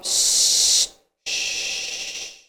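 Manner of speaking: long unvoiced shush for silence; librarian shushing whisper. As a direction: hush a whole schoolyard with one shush. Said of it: "Ela falava".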